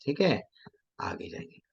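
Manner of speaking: barely audible whisper; voiceless, hushed murmur to move things along; whispered aside. Real, not acted. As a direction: drop to a very soft whisper. Aside before moving on.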